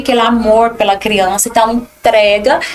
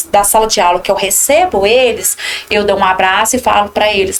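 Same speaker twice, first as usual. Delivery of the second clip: ordinary delivery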